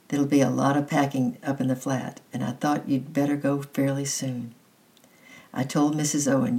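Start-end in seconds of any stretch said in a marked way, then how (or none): none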